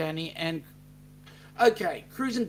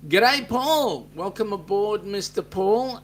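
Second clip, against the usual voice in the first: much higher and louder g'day; excited greeting; 6 semitones higher, 8 dB louder than usual. Real, not acted. Host welcomes a new viewer, excited loud greeting. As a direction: call out a big welcome.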